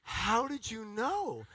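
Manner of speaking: slow, drawn-out amazed question; amazed friend. Retold or imitated. Friend stunned by the psychic guess, slow amazement. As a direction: sound stunned. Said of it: "And they're gonna go".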